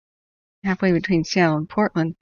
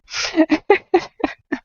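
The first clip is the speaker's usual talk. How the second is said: high laugh; 11 semitones higher than usual. Real, not acted. Laughing at his own Karen act, high laugh. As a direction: laugh breaking out high mid-rant.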